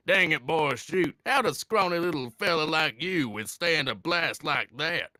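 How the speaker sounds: put-on loud, high, over-the-top Southern US accent; Super Android thirteen. Quoting a Dragon Ball Z character, exaggerated Southern American accent. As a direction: ham up a ridiculous Southern American villain. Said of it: "Southern U S A accent where he's going on about".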